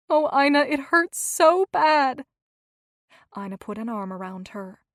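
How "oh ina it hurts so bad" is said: aching grief. A narrator's reading. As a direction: let the grief break through. Remembering husband's death.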